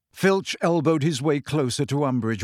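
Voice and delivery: ordinary delivery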